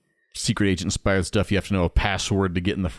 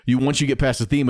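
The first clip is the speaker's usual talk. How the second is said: ordinary talk, nothing marked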